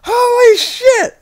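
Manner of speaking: very high, slow, loud exclamation; mock ecstatic cry. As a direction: shout it in amazed delight. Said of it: "he's like".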